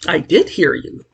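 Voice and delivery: ordinary delivery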